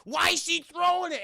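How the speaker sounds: high, loud, slow put-on Karen yelling; angry Karen shout. Motel owner yelling about the broken window, high shout. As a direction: shriek at the kid like an angry neighbour. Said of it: "She's like".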